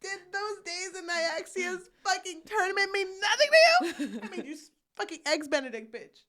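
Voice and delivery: loud, high, wailing melodrama; hammed-up tearful tirade; mock crying. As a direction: wail it through fake sobs and laughter. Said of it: "He starts crying".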